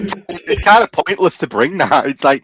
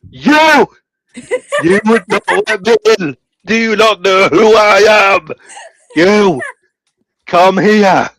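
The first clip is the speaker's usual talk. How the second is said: mock outrage; 4 semitones higher than usual. Offended guest confronting the volunteer, mock outrage. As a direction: play the offended star, hammed up. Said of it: "Vince Nicola comes on".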